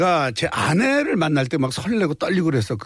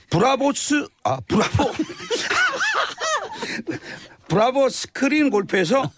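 laughing through a read; 9 semitones higher than usual. Real, not acted. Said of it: "이야, 형님".

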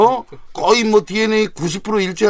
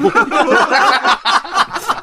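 high laugh; 11 semitones higher than usual. Real, not acted. Host laughs.

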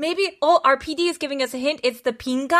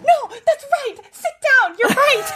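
laughing; 12 semitones higher, 3 dB louder than usual. Retold or imitated. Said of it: "literally stood up and was like".

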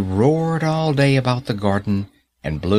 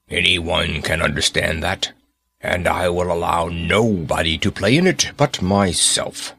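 gruff giant; 4 semitones lower than usual. Acted. Selfish giant continues speaking.

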